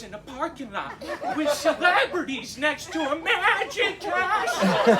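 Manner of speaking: lightly voiced rambling grandpa; old man voice. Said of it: "I'm gonna have the old man voice".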